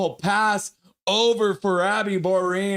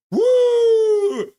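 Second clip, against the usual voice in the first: much higher, louder whoop; celebratory whoop; 8 dB louder than usual. Real, not acted. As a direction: cheer at the top of your voice. Celebrating again.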